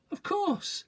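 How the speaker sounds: cheery. A narrator's reading. Father, very high.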